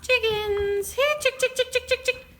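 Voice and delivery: high calls to the hens; calling chickens. Real, not acted.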